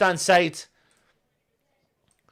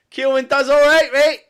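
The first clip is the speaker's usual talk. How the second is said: mock shout; 8 semitones higher, 6 dB louder than usual. Real, not acted. Louder mock repeat.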